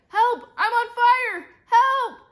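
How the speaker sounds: very high, loud panicked cries; scream. A narrator's reading. Girl whose gown is on fire, screaming. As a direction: scream for help at full voice. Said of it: "Emily screamed".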